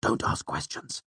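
slow, tense hissed whisper; urgent whisper. A narrator's reading. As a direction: whisper a hard warning. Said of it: "Bast hissed in his ear".

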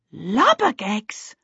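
slow, dismayed gasp of the words; mild horror at the news; a touch of alarm; shocked exclamation. A narrator's reading. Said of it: "Peter and Charmaine exclaimed almost together".

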